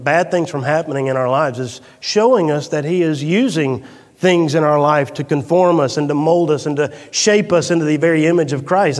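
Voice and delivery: ordinary delivery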